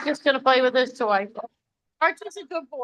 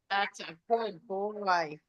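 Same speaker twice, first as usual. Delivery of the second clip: soft, slow, gentle praise; soft praise to dog; 3 semitones lower, 8 dB quieter, slower than usual. Real, not acted. Dog owner softly praising her dog, gentle and slow. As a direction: murmur it to your dog.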